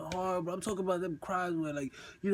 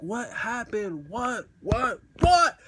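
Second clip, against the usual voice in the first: each "what" higher, the last one yelled; escalating disbelief; 4 semitones higher, 7 dB louder, much slower than usual. Retold or imitated. Boyfriend pressing her on the phone for the confession, escalating disbelief. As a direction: build from puzzled to a high, loud "what?".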